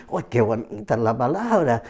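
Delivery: "Oh, qué bonita es la palabra" dropped low and soft. Retold or imitated. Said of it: "Muchos dicen".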